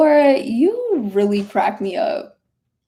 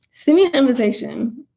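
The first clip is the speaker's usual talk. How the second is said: ordinary talk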